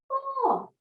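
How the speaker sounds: put-on a high, wondering oh for the kids; mock surprise. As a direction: gasp in playful wonder at the picture. Teacher exclaims to children over picture.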